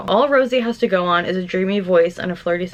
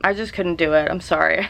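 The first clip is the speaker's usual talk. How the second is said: ordinary delivery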